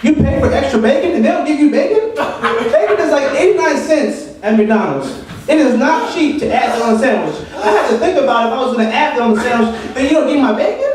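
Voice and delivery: high-pitched, laughing exasperated rant. Real, not acted. Comic ranting to audience about missing bacon, high incredulous laughter. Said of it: "am I ranting?".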